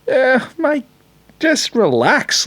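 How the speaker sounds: high, slow mocking fan voice; lightly sent-up footy fan; mock Carlton fan. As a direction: take off a whingeing rival supporter.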